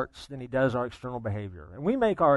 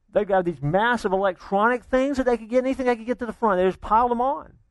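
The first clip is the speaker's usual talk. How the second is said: higher and louder preacher's build over a fast retelling; preaching at full force; 6 semitones higher, 4 dB louder than usual. Acted. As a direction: drive the story home from the pulpit. Preaching builds over the shopping rush.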